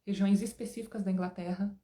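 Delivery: ordinary talk, nothing marked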